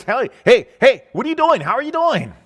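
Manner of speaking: high, loud greeting; eager calling out. Acted. David running up to his brothers, calling out eagerly. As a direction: call out high to get their attention.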